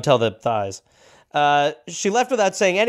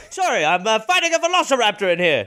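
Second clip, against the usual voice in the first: higher silly voice; Cooper excuse; 6 semitones higher than usual. Pretend. Cooper bluffing the sergeant, higher silly voice. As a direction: toss off the absurd excuse.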